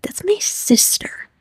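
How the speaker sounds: high, soft, slow whisper; hushed high whisper. Retold or imitated. Lizzie pointing out it's her sister, high hushed whisper. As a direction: breathe it high and quiet.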